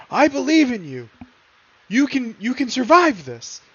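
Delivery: high, earnest pleading encouragement; encouraging. Acted. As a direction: urge a fallen friend to live. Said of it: "I'm going to look at Zafrina and go".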